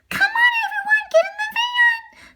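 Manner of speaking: high, calling Labrador voice. Acted. Labrador calling the puppies into the van, high. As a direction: call them all into the van.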